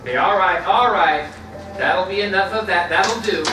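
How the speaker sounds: loud, firm shout to settle them down; stern call to order. Acted. Minister breaking up the guests' scuffle, loud stern call. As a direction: bark it out to stop the horseplay.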